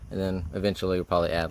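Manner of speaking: ordinary talk, nothing marked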